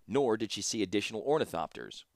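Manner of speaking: usual speaking voice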